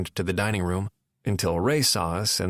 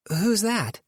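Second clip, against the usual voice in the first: Nora voice; 11 semitones higher than usual. A narrator's reading.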